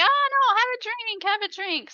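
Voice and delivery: high, coaxing mock voice of a drunk partner; wheedling high voice. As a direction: push the drink on her in a high sing-song. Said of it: "she's like".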